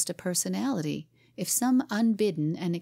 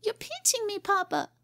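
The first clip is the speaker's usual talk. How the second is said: put-on a much higher wailing little girl; child's scream. A narrator's reading. Cora complaining to her father, high child's wail. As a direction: whine it out like a pinched child. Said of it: "she screamed".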